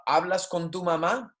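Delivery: "hablas con tu mamá" climbing high on "mamá"; question intonation. Shown as a demonstration. Spanish teacher showing how inflection makes a question, rising intonation. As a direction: ask "hablas con tu mamá" with a rise at the end. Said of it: "that's a change of inflection".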